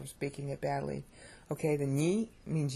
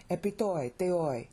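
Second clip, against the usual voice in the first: "Epi toi teoi" as a closed, falling phrase; pause after phrase. Shown as a demonstration. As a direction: finish "teoi" and stop before going on. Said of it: "and then you pause".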